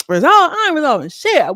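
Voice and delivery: high, loud scoff at resolve; mock outraged refusal, high and loud; indignant outburst. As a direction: throw the word back in disbelief. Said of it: "She's like".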